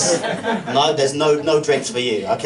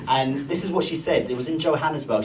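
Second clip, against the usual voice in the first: usual speaking voice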